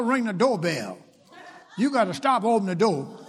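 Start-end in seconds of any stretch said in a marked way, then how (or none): none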